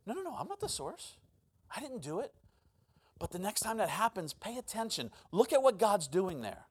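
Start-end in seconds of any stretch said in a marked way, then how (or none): 0.1-2.3 soft, high-pitched denial; mock protest. Preacher disowning credit for others' conviction, mock protest. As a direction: back away from the blame, softly. Acts out denying being the source.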